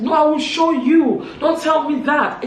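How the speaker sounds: high, loud threatening shout; fighting words. Retold or imitated. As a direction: re-enact a heated fight. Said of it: "there's the fighting".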